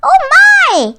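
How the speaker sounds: high, loud fussy wail. A narrator's reading. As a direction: wail in mock alarm. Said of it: "wailed Tweed".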